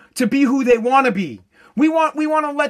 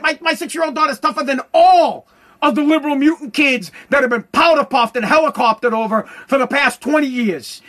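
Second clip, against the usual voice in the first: ordinary talk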